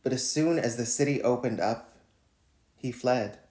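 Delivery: measured, deliberate poem reading; poetry reading. Acted. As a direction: read each line like a spoken poem. Reads his poem Quarantine Lover.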